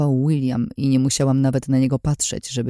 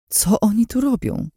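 higher-pitched, stunned question; choked out; 8 semitones higher than usual. A narrator's reading. Narrator reacting to the equipment, choked-out question. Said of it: "wykrztusiłam w końcu".

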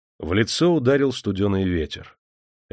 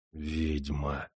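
much softer, low inner curse; lower, hushed cursing to himself; silent curse; 6 semitones lower, 10 dB quieter than usual. Acted. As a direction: spit the word under your breath, as a thought. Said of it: "мысленно выругался Демидов".

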